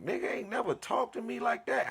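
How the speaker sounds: high, stunned inner voice; hurt disbelief. Retold or imitated. Friend brushed him off, high hurt disbelief. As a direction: say it high, wounded and shocked. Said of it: "I'm like".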